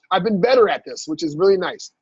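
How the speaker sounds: usual speaking voice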